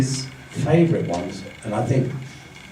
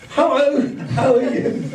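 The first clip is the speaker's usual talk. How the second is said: very high, bubbly mock hello; put-on perky high voice; over-cheery greeting; 13 semitones higher than usual. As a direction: greet them far too chirpily. Acted mismatched greeting to sad person.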